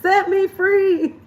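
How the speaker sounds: high, slow, loud pleading cry; mock plea. Vlogger crying out to be freed from scrolling, high and drawn out. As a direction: beg to be set free, hammed up.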